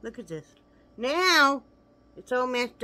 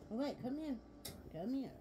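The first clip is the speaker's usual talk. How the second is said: high, softer coaxing to the dog; soft pet talk; 6 semitones higher, 12 dB quieter than usual. Real, not acted. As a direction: softly coax the pet closer.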